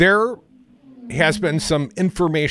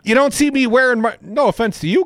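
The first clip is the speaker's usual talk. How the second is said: ordinary talk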